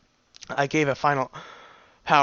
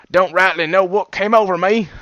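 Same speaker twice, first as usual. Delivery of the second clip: put-on louder, raised, Southern-tinged mixed accent; pony with a jumbled accent; odd mixed accent; 5 semitones higher, 7 dB louder than usual. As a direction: apologise in a muddled put-on accent. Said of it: "His odd accent".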